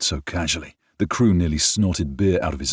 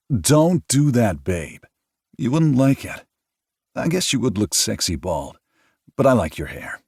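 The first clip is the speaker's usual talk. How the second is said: lightly voiced, playful and raised; teasing partner; 8 semitones higher, 4 dB louder than usual. A narrator's reading. Noah teasing Ford about going bald, playful. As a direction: tease him fondly.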